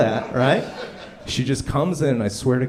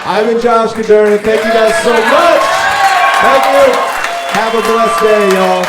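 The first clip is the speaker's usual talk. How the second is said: excited sign-off; 10 semitones higher, 12 dB louder than usual. Real, not acted. Big loud sign-off.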